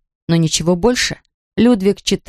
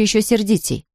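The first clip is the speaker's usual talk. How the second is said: ordinary delivery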